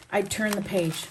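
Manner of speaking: usual speaking voice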